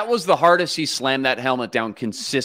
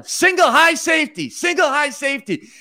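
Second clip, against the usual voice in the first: imitated yelling; 12 semitones higher than usual. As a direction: yell the call out like an angry quarterback. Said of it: "And so he's yelling".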